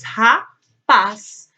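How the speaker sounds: "Rapaz" as loud "Ra", pause, "paz"; split into syllables. Shown as a demonstration. Teacher confirming two syllables, "Rapaz" syllable by syllable. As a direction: break "Rapaz" firmly in two.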